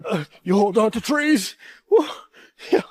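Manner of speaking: slow, grave survival-show host; Bear Grylls. Pretend. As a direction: narrate like a TV survivalist. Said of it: "I'm Bear Grylls".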